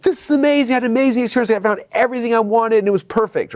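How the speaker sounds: high, gushing thrilled voice, easing down; delighted customer. Retold or imitated. Happy customer raving after shopping, high gushing delight. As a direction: gush about the perfect shopping trip. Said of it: "his happiness level goes up".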